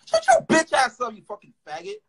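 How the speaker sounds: scornful clapback. Real, not acted. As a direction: start loud and high, drag out the insult. Snaps back at the mocked commenter.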